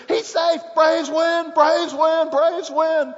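loud, high, repeated victory call; ecstatic announcer call. Retold or imitated. Skip Carey calling the Braves' winning run, loud and high. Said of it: "he says".

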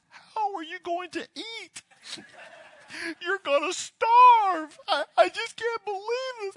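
high, drawn-out mock wail; hammed-up crying mom. Mom reacts to son leaving the phone company, hammed-up crying. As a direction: wail it out in exaggerated, high-pitched distress. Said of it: "she's crying like crazy".

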